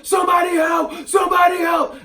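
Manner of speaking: high, loud cry for help; shouting for help. Retold or imitated. Robbed woman in the street yells for help, loud and high. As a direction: yell it over and over like she's just been robbed. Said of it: "went on and on".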